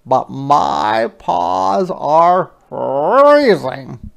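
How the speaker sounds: otter voice. Acted. Otter greets Hedgehog, slow and puffing. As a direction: huff it out like a cold otter. Otter complains of cold paws.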